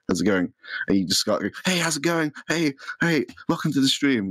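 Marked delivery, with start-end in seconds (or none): 1.6-2.3 excited, high-pitched greeting; really enthusiastic. Acted. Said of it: "Like, really enthusiastically". 2.5-4.3 excited, high-pitched greeting; really enthusiastic. Acted. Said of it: "Like, really enthusiastically".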